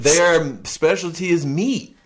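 high, slow ad voice; mock announcer. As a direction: say it like a cheesy commercial. Joking about Arby's meat.

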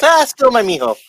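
doting mom. Retold or imitated. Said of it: "and she goes".

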